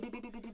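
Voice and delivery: high, quick popping boops; mock gunfire boops. Narrating the soldiers shooting Bob, high mock boops. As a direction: pop out each boop like gunshots.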